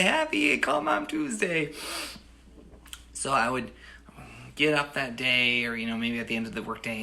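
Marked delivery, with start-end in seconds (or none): 0.0-1.7 high, slow mock-mom greeting; imitating mom. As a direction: answer in mom's chirpy high voice. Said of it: "And she'd say".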